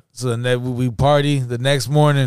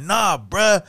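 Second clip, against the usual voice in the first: mock-mad, pitched up in exasperation; retold mad; 7 semitones higher, much slower than usual. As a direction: act out getting mad at your friend. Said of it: "And I got hella mad".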